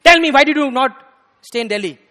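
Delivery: loud, high burst, easing at the end; acted-out angry shout at a silent disciple; angrier guru. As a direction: demand an answer furiously. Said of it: "Tamakrishna Maharaj got more angry".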